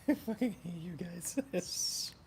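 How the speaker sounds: slow, laughing through the words; fake-scold the chat while cracking up; playful laughing jab; laughing mock insult. Real, not acted. Laughing at chat's joke.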